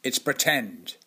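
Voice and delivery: put-on testy old director-actor lecturing a child actor, loud; tetchy Hartnell. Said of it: "He'd get a bit tetchy with you and say".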